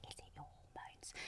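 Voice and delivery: hushed, breathless asking; whispered quote. Retold or imitated. A doctor retelling patients' nervous question, whispered. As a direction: whisper it like a secret worry. Retelling what people ask her.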